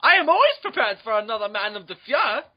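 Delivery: proud Nazi officer in a cartoon accent; German officer accent. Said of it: "recurring characters is a Nazi".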